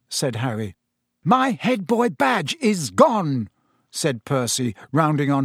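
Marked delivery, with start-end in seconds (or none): 1.2-3.5 slow, high-pitched indignant cry; outraged. Acted. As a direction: announce the loss as an outrage. Percy, still shouting, rounds on Harry.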